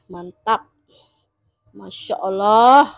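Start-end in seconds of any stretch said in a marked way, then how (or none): 1.7-2.8 loud, slow Masya Allah; awed exclamation. Real, not acted. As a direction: marvel at it out loud. Awed at the bowl. (seruan kagum)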